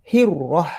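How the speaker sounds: "Hirrah" with doubled r; correct pronunciation. Shown as a demonstration. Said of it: "Dia sepatutnya ada sabdu". (sebutan betul dengan sabdu)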